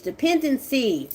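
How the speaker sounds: ordinary talk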